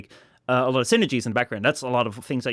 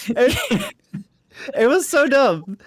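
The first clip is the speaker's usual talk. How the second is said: high, slow laughing rant; laughing disbelief; 12 semitones higher, 3 dB louder, slower than usual. Real, not acted. Mocking early top-ten list makers, squealing with laughter. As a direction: squeal out the absurdity.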